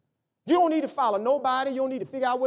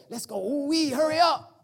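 excited hurry; 5 semitones higher, 4 dB louder than usual. Retold or imitated. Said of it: "And I say".

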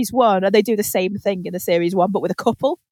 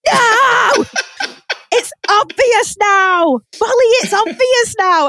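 high, loud wail at the screen; exasperated yelling; 12 semitones higher, 5 dB louder, slower than usual. Acted. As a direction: yell it slowly at someone hopelessly clueless. Viewer yelling at clueless Molly.

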